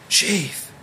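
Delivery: high, eager call of Chief; excited exclamation. Retold or imitated. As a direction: blurt it out wide-eyed after the first bite. Chef excitedly tasting the dish.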